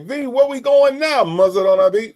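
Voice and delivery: playful quote voice. Pretend. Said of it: "'Cause I might be like".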